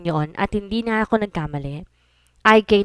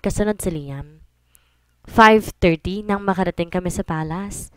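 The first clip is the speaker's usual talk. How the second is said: ordinary delivery, nothing marked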